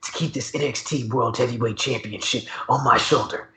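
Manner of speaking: low, softened mock trash talk; talking cash shit. Wrestler vowing to keep his title, mock trash talk. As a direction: imitate a wrestler's boast, low and quiet. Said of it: "he be talking cash shit".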